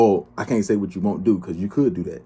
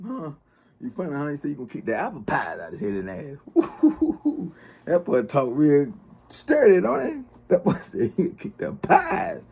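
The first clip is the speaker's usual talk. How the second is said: high, giggling mockery; mocking laughter; 7 semitones higher than usual. Real, not acted. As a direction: laugh at him while teasing. Mocking the other guy, laughing.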